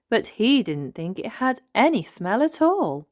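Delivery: ordinary delivery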